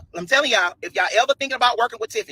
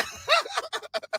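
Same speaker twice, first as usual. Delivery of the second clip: high shrieking laugh; 13 semitones higher, 7 dB quieter than usual. Real, not acted. Laughing at the age insult.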